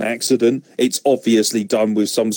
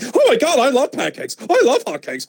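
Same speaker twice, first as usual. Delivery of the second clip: much higher, excited mock child voice with laughter; giddy kid; 11 semitones higher than usual. Mocking Byron Saxton as a gushing child, high squeal. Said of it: "'Cause he's like".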